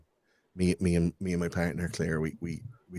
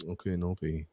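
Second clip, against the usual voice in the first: meek agreement; 8 dB quieter than usual. Retold or imitated. Meekly agrees to partner's rule.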